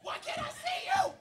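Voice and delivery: high, loud shout of confusion; calling out. Acted. Blinded by sand, shouting to his friend. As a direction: yell blindly into the storm.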